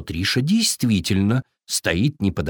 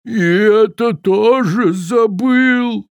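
slow, loud booming sad giant; a giant dismayed at forgetting; upset giant; 9 semitones higher, 5 dB louder, slower than usual. A narrator's reading. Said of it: "великан расстроился".

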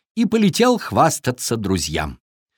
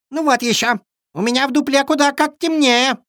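very high, squeaky squirrel voice; squeaking squirrel; 14 semitones higher than usual. A narrator's reading. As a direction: pipe up in protest as a squirrel. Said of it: "запищала подоспевшая на шум белка".

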